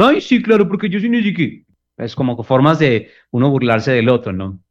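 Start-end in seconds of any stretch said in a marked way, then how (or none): 0.0-1.6 very high, whiny mimicry of the partner; mimicking a partner's voice to mock them; ridiculing imitation. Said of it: "Imitar la voz del otro con tono ridiculizador".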